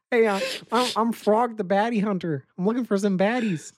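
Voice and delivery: high puppet-frog voice; Kermit the Frog. Retold or imitated. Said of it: "he does the Kermit voice".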